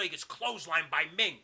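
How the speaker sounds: ordinary talk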